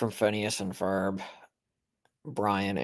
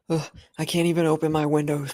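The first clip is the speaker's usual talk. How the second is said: annoyed, higher grumbling complaint; annoyed ugh; 6 semitones higher, faster than usual. Real, not acted. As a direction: ugh it out in exasperation. Complaining about windows without screens.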